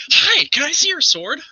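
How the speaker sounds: put-on high, childlike cat-folk voice; high-pitched eager catfolk; eager Chester. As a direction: pipe up with an innocent request. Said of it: "Chester goes running up and says".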